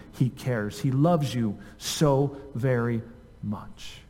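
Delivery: soft, heartfelt pastoral tenderness; tender reassurance. Real, not acted. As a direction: comfort them gently, sinking to a hush. Preacher reassures congregation of God's love.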